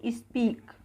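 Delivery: स्पीक starting on a half s sound; model pronunciation. Shown as a demonstration. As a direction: begin स्पीक with a short s, no i. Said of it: "आधा सा से start होगा".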